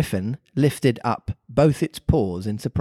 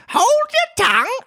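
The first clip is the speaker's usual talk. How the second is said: very high, squeaky creature voice; Griffin voice; 5 dB louder than usual. A narrator's reading. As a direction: squeak it out, scolding.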